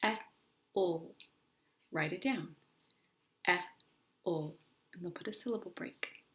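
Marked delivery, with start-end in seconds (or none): 0.0-1.2 "E, O" as two separate phonics sounds, short e then l; "E, O" segmented with a pause between; sounded out. Shown as a demonstration. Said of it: "What sounds do you hear?". 3.5-4.6 "E, O" as two separate phonics sounds, short e then l; "E, O" segmented with a pause between; sounded out. Shown as a demonstration. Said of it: "What sounds do you hear?".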